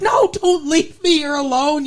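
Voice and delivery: high-pitched, distraught mock plea; freaking out. Stepmother begging her dying husband to stay, freaking out. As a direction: wail it like a panicked new widow. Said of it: "she is freaking out".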